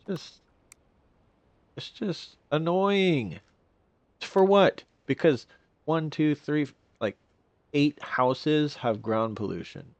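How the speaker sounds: high, drawn-out whiny gripe; whiny complaining. Real, not acted. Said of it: "I just sound like a whiny bee right now".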